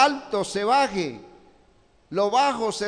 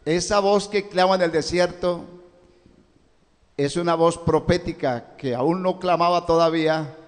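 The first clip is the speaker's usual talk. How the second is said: ordinary talk, nothing marked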